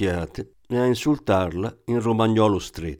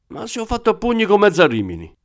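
much higher, fast and offended; the thief boasting of brawls across Rimini; indignant boast; 11 semitones higher, 3 dB louder, faster than usual. Acted. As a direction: fire it back to prove he's tough.

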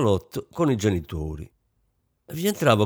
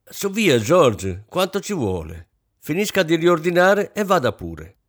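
sharp, higher and louder command; brusque order; 7 semitones higher, 4 dB louder than usual. A narrator's reading. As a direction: snap the order at the servant, embarrassed. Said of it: "arrossì e ordinò bruscamente". (ordine brusco e imbarazzato)

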